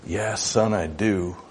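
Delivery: soft, low fatherly reassurance; tender and quiet; loving. Acted. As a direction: answer gently, like a patient father. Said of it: "lovingly looks and says".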